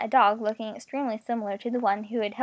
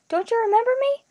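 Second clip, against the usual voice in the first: very high, loud distressed plea; mounting alarm, pitched way up at the end; voice rising higher; 12 semitones higher, 5 dB louder than usual. A narrator's reading. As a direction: climb to a high, pleading pitch. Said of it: "Her voice was rising higher".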